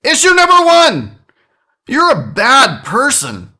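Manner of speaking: high, loud segment announcement; announcer voice. Acted. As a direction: announce it like a game show host.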